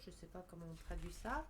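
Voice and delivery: ordinary talk, nothing marked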